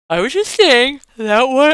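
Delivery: words pulled by a yawn. Real, not acted. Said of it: "I'm yawning again".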